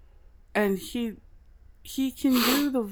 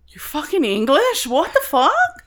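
rising, louder shocked question; shocked disbelief; 6 semitones higher, 4 dB louder than usual. Retold or imitated. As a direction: voice the crowd's disbelief, climbing high. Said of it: "everybody was like".

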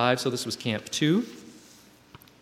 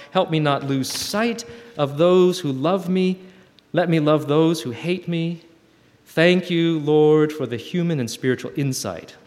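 earnest reading of a prayer, some slow lines; a sincere prayerful reading; reading a prayer letter; 3 semitones higher, 3 dB louder than usual. Acted. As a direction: read it like a heartfelt prayer.

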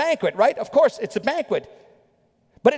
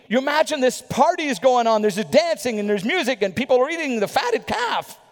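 normal talking voice

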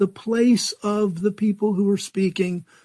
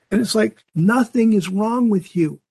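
normal talking voice

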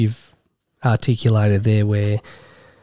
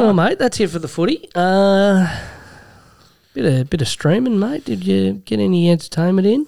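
higher, broad Aussie tradie voice; aussie tradesman; 8 semitones higher than usual. Pretend. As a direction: ham up the true-blue bloke. Hammed-up blokey segment transition.

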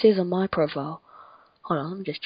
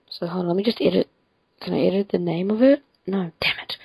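usual speaking voice

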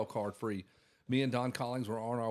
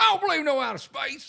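high, loud mock protest; mocking imitation; 8 dB louder than usual. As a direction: mimic a stubborn denier, pitched way up. Mimicking fighter's denial of outer space.

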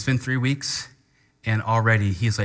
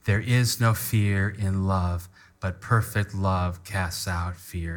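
pace it so everyone can follow; gentle guided scripture reading; slow unison reading; 4 semitones lower, slower than usual. Shown as a demonstration. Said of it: "read that with me that far".

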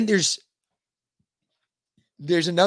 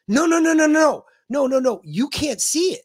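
1 insistent mock protest; 10 semitones higher, 5 dB louder than usual. Customer insisting, retold quote.

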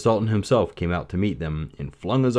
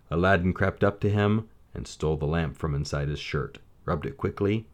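ordinary delivery, nothing marked

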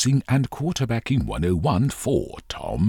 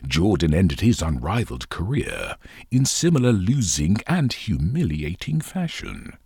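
ordinary delivery

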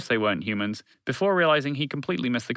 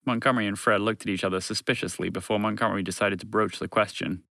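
ordinary delivery

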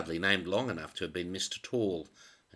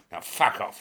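curt dismissal; 7 dB louder than usual. Acted. Boss dismissing Zimmerman.

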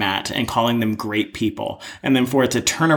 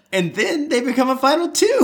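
high, bursting into laughter mid-line; can't get through it without giggling; laughing; 13 semitones higher than usual. Real, not acted. Co-host cracking up at the joke about George, laughing.

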